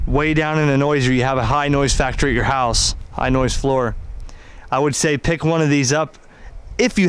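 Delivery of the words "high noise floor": normal talking voice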